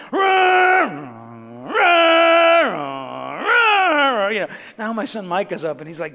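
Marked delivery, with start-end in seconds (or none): 0.1-4.3 loud, high sputtering engine noise; mock car stalling sound. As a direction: imitate a struggling engine turning over. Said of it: "and the car's going".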